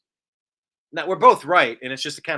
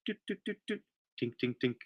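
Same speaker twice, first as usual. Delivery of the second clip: mouth sound effects; 11 dB quieter, faster than usual. Pretend. Playing a little celebration sound.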